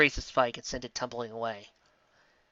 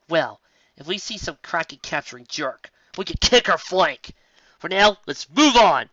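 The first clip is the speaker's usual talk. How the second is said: cocky bravado; 4 semitones higher, 7 dB louder than usual. Acted. Lyra tough talk.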